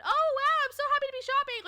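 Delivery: high, loud, fast gushing; mock chipper. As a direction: gush with exaggerated fake delight. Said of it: "all chipper and happy".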